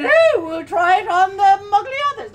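high and loud; loud call. Acted. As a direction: call it out to the house. Calling for fitting.

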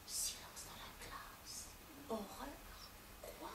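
slow whispered aside; hushed narration. A narrator's reading. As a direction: whisper it slowly for suspense.

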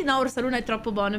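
ordinary talk, nothing marked

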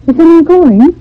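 loud, high call into the dark garden. Acted. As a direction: call out uneasily to someone unseen. Hears a voice, calls out.

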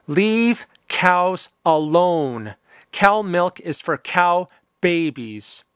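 each word slow and firm; slow, deliberate appeal. Real, not acted. The vegan host ends by pleading with viewers, slow and firm. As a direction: spell out the message slowly so it sinks in.

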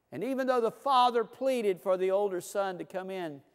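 ordinary delivery

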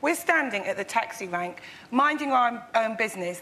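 ordinary talk

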